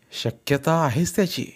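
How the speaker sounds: ordinary delivery, nothing marked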